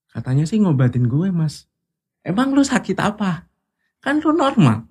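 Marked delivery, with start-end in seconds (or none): 2.2-4.8 colleague's disbelief. Retold or imitated. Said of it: "Dia bilang gini".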